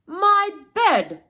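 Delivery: high, loud sputtered outburst; sputtering. Acted. Papa bear finds his bed disturbed, sputtering loudly. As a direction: blurt it out in shock. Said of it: "Papa Bear sputtered".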